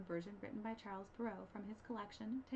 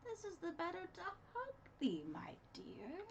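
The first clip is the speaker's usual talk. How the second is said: sly wolf; 8 semitones higher than usual. Acted. Wolf answering girl.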